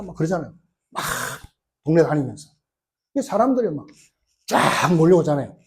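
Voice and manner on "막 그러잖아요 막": ordinary talk, nothing marked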